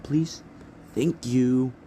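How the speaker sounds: lightly acted sweet sarcasm; mock politeness. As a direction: tack on exaggerated manners. Izuku's mock-polite request.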